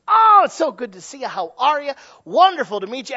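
high, loud gushing hello; mock holiday greeting. Relative greeting family at Thanksgiving, loud and gushing. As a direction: gush like you're meeting distant relatives at Thanksgiving.

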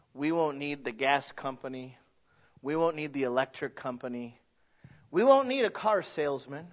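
usual speaking voice

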